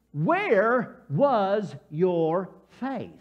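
very slow, word-by-word reproach; deliberate, weighted question; slow rebuke. Retold or imitated. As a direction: space out each word in stern reproach. Acts Jesus rebuking the disciples.

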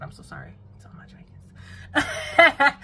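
high, loud, whispery laughing apology. Real, not acted. Vlogger apologizing to Jamaican viewers for her accent, laughing. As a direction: giggle through the sorry.

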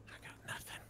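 hushed whisper. Real, not acted. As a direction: whisper it to yourself. Quiet aside while sniping.

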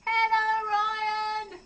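high, loud call, as if across the world to Australia; cheerful long-distance yell to a child; playful shout. Pretend. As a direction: shout the greeting so it carries far. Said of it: "Need to shout".

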